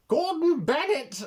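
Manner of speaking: high, loud, slow Cockney cry of Gordon Bennett; Cockney exclamation. Retold or imitated. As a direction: bellow it like a startled Cockney lad. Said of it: "they are all Cockney".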